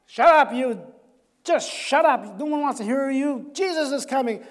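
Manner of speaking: sharp annoyed rebuke; crowd hushing him. Acted. Crowd rebuking the blind beggar, sharp annoyed rebuke. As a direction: shut him up, irritated. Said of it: "kept screaming".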